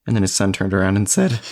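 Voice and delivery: normal talking voice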